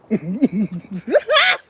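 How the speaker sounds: real uncontrolled giggling; laughing fit. Real, not acted. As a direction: giggle rising to a squeal. Cracking up at her own remark.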